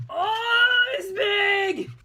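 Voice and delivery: loud, high, drawn-out cry; straining exclamation. Real, not acted. Maker showing off an enormous gun, loud exclamation. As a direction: belt it out high and long.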